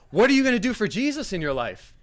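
high, loud challenge; mock exhortation. Dad challenging guest between songs, loud mock exhortation. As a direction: exhort loudly like an overzealous host.

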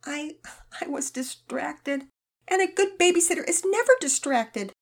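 put-on high girl voice, halting then rising; a shamed girl admitting fault; ashamed girl.

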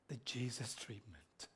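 low, hushed reveal; whisper. Real, not acted. Preacher telling congregation what they get, whispered. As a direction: let it fall to an awed whisper.

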